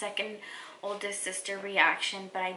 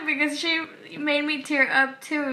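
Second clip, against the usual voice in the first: louder, higher, laughing through tears; teary laugh; 7 semitones higher, 4 dB louder than usual. Real, not acted. Explaining to viewers why she cried, laughing through tears. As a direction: laugh it off while still emotional.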